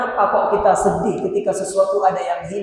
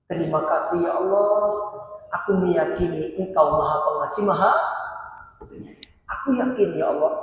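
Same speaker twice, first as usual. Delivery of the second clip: doa yang lirih; 3 semitones lower, 7 dB quieter than usual. Acted. Mencontohkan doa kepada Allah.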